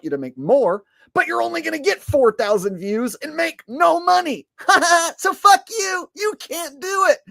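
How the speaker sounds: high, loud mocking rant; mock the other channel, shrill and loud. Mock ranting YouTube voice.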